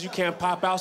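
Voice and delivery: usual speaking voice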